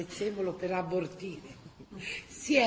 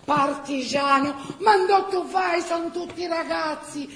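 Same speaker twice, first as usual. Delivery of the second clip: wife protesting, higher and louder; exasperated wife; 8 semitones higher, 6 dB louder than usual. Acted. Gina confronts her old husband leaving for the partisans, high protesting cry. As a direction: scold him for going off, voice rising high.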